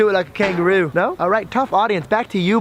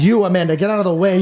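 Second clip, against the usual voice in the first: ordinary delivery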